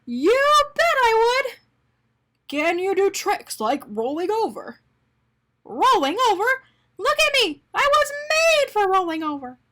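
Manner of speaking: high, loud eager yelps of joy; bursting with delight at the offer; thrilled. Acted. Arnie overjoyed to be Mister Bing's dog, high and loud.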